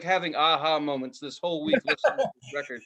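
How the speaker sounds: usual speaking voice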